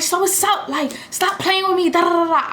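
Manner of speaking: high, confrontational mock challenge; squaring up. Dropping her bag to face the girl, a high mock challenge.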